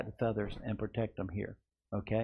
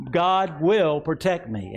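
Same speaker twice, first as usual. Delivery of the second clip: slow and higher, proclaimed for the church to echo; slow, raised declaration; 4 semitones higher, 4 dB louder, slower than usual. Shown as a demonstration. Pastor leading the congregation in a slow proclamation. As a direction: proclaim it slowly and strongly. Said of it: "Say it again".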